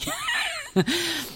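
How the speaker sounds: high embarrassed giggle; a teary little laugh. Real, not acted. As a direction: laugh off the tears. Embarrassed laugh after emotion.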